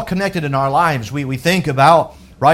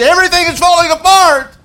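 higher, louder alarmed cry; preached outburst; 11 semitones higher, 7 dB louder than usual. Acted. Pastor to congregation, loud alarmed peak. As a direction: let it burst out. Climax of worry sermon.

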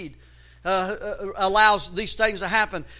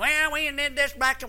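higher-pitched mock old-timer grumble; mocking complainers; 5 semitones higher than usual. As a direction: imitate people harping on the past. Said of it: "I'm tired of hearing people".

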